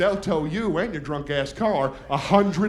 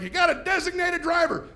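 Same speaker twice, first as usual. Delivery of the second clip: nagging parent; 8 semitones higher than usual. Retold or imitated. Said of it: "My parents always said things like".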